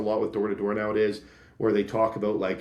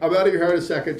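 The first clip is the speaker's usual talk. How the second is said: higher and louder, quick reassuring pitch; breezy salesman; 7 semitones higher, 6 dB louder, much faster than usual. Retold or imitated. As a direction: keep them on the doorstep with a bright push. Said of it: "And he'd be like".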